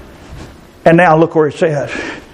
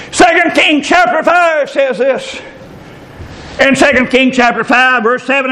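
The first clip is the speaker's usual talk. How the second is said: preached reference; 8 semitones higher than usual. Shown as a demonstration. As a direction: proclaim "Second Kings chapter five says this" to the hall. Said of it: "repeats reference preached".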